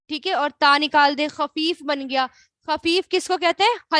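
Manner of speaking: ordinary delivery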